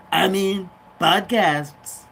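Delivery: slow Trump-style emphasis. Retold or imitated. To viewers, slow Trump boast. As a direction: drag it out like Trump.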